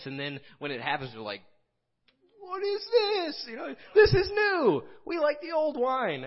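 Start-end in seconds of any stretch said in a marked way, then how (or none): 2.4-6.2 high, loud mock whine with a crying edge; whiny complainers. Preacher mocks believers clinging to the old, whining loud. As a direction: whimper it like a spoiled child who wants the old. People resisting the new, mocked.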